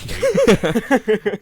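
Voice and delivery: loud, high mock British jeer; over-the-top British accent, shouted; mock British insult. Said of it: "more the British".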